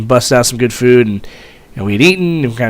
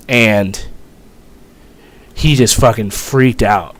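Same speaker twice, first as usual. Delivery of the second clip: ordinary delivery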